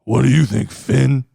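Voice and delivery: low, deep mock Damian line; imitating Damian's catchphrase. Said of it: "his patented".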